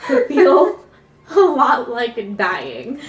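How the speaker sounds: slow, breaking into laughter; laughing. Real, not acted. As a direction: laugh through the words. Describing gas pains as dying.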